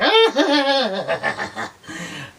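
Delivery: high, loud mock villain cackle; villain laugh. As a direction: cackle like a gloating cartoon villain. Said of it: "laughs away".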